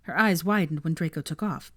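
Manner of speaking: usual speaking voice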